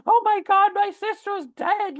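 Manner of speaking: frantic high-pitched wail of alarm; freaking out. Retold or imitated. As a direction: sister on the clifftop, panicking that she fell. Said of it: "I can hear her, like, freaking out".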